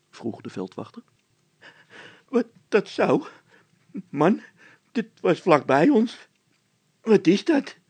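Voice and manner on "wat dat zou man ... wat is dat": high, loud panicky voice; frightened Bol. A narrator's reading. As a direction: panic about the noise. (Bol in paniek, hoog en luid)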